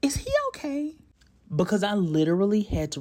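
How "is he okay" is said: high-pitched mock concern; imitating worried listener. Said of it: "you're not like".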